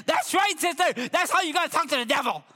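high, fervent cheering-on; retold preacher's excited approval; excited anointed preacher. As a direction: exclaim it high, rushing the ending. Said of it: "got all excited".

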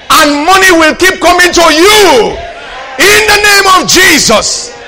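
shouted, slow high preaching cry; hoarse top-of-the-lungs sermon shout; full-force preaching. Acted. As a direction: thunder it out like a revival preacher.

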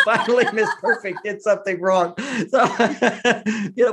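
talking through a real laugh. Real, not acted. Husband laughs recalling wife's mistake, laughing through words. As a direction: giggle out the punchline.

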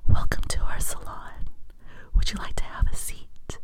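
whispering salon greeter; hushed invitation to sit down; whispered asmr role play. Shown as a demonstration. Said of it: "it'll go something like this".

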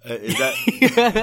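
squeaky laughing question. Real, not acted. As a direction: laugh through the words.

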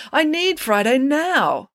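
whiny insistence. A narrator's reading. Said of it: "complained Parker".

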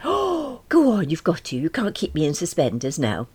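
high, insistent urging; eager coaxing. Acted. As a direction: coax the secret out of her. Character coaxes Rose for the secret.

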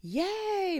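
high, loud cheer; excited yell. A narrator's reading. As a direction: shout it with joy. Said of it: "Maria yelled into the walkie-talkie".

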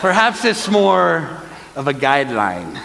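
drawn-out, hammed-up pirate accent; bad movie pirate accent. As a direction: do a terrible Pirates of the Caribbean accent. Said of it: "I don't know what that accent is".

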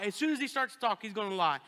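normal talking voice

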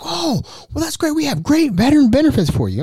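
high, gushing telemarketer; high salesman voice. Retold or imitated. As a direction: gush like the caller. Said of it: "It's like".

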